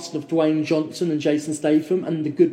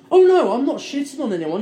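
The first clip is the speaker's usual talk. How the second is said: high-pitched mock protest; lightly acted, higher-voiced denial; retold protest; 7 semitones higher, 4 dB louder than usual. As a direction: imitate someone hastily denying blame.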